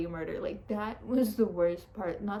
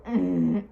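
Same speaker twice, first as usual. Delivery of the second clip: loud closed-mouth grunt; muffled push grunt, lips shut; held-in strained grunt; 2 semitones lower, 10 dB louder than usual. Retold or imitated. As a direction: bear down with a muffled mm. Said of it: "I couldn't go".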